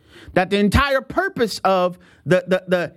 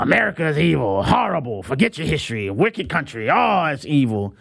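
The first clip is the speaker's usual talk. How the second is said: mocking critics; 6 semitones lower than usual. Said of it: "the forces ... working overtime".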